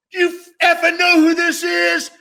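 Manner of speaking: high-pitched shout to a whole store; retold yelling. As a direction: bellow the introduction so the crowd hears, imitating him. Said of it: "he starts yelling".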